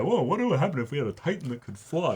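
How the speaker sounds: lightly voiced excited writers pitching an idea; a quick, bright hint of mock wonder. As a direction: toss off the question as a giddy brainstorm. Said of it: "they have basically".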